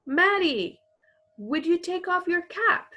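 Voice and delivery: high polite call. Acted. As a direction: call it out with a lift. Acting out a photographer's command.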